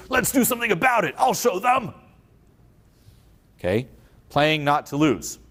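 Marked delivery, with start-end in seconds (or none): 0.1-1.9 mock defiance. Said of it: "Me versus".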